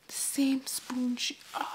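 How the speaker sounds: stunned unvoiced whisper. Real, not acted. Son realizing he shared mom's spoon, whispering in shock. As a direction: breathe it in shock.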